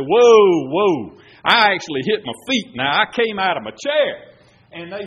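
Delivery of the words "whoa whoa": retold exclamation. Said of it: "I jumped up and I said".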